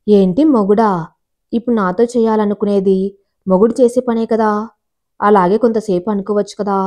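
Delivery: usual speaking voice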